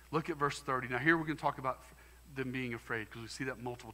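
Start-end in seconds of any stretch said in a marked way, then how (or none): none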